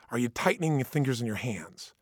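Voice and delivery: usual speaking voice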